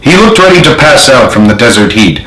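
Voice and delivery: ordinary delivery, nothing marked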